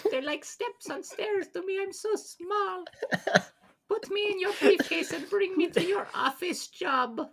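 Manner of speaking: tiny high falsetto, softened; little guy voice. Acted. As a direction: confess it as a teeny helpless creature. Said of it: "your best little guy voice".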